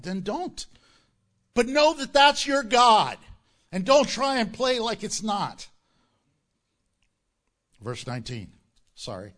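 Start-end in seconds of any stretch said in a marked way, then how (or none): 1.5-5.7 loud, forceful warning; stern rebuke. Real, not acted. Pastor warning listeners about their idols, loud and stern. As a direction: hammer the point home. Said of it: "rebuking congregation".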